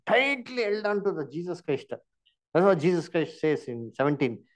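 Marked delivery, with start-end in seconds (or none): none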